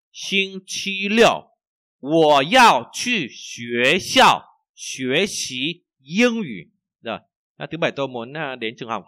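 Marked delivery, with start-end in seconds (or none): 0.1-6.7 slow, loud, carefully spaced Chinese for learners; slow model reading. Shown as a demonstration. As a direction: read each syllable slowly for the class.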